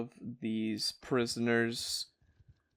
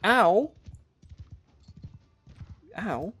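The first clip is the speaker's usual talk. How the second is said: mock pained yelp; 9 semitones higher, 4 dB louder than usual. His unit gets hit by rocks.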